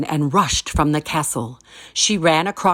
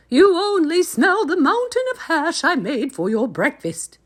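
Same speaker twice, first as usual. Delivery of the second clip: very high squeaky giantess; 11 semitones higher than usual. A narrator's reading. Giantess hushing her husband, high and squeaky. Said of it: "said the giantess".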